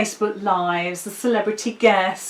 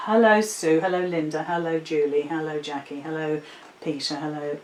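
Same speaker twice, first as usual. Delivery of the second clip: low monotone list of hellos, trailing softer; droning roll-call; 5 semitones lower, 4 dB quieter than usual. Acted. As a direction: drone through the greetings as if endless. Mimics greeting everybody one by one.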